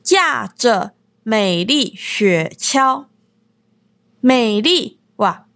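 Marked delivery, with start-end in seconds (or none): 0.0-3.0 slow lyric reading. Shown as a demonstration. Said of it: "はい、次。".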